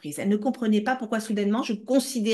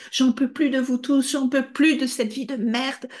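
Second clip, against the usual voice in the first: loud, high, desperate screaming; mother screaming while hitting her child; screaming in rage; 7 semitones higher, 4 dB louder than usual. Retold or imitated. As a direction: scream it out at everyone. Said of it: "où elle criait".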